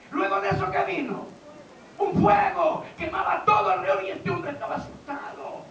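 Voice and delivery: loud, high sermon storytelling; dramatic preaching. Acted. Preacher telling of the fire before Elijah, loud proclamation. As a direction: throw the question out, then proclaim the answer.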